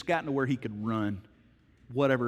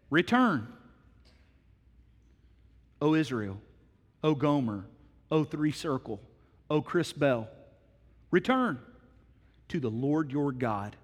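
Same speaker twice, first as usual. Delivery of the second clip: scripture proclamation. Real, not acted. Reading Hosea's call to return.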